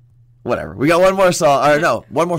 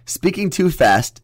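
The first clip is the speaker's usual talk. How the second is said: deliberate reading; 6 semitones higher, slower than usual. Shown as a demonstration. Reading slowly, deliberately, about speaking fast.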